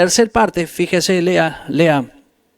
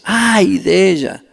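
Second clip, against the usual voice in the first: slow voice of God; slower than usual. Acted. God's voice quoted in sermon.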